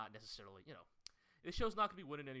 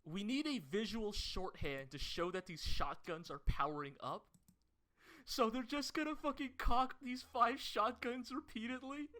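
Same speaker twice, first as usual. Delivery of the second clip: excited; 10 semitones higher, 5 dB louder than usual. Retold or imitated. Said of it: "who was like".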